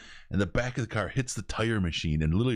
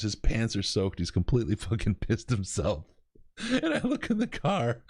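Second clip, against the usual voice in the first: ordinary delivery, nothing marked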